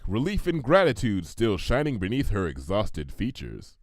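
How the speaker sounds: plain reading voice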